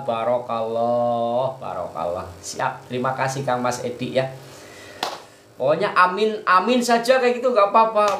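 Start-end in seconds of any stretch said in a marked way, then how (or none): 5.6-8.2 excited. Real, not acted. Cheering viewers' amin replies.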